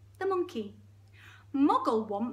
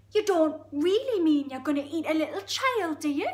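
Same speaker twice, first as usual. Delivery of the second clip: Geordie hippo; 6 semitones higher, 9 dB louder than usual. Acted. Hippo realising the crocodile's plan, aghast Geordie. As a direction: draw out the horror in Geordie. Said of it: "It's really Geordie".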